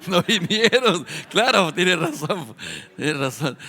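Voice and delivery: real laughter through the words; amused, laughing. Real, not acted. Preacher joking with congregation about who is missing, laughing.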